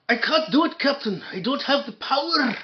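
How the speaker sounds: high, loud mock-Scottish cry; hammed-up Scottish panic to the captain; Scotty. Engineer pleading with the Captain, hammed-up Scottish accent.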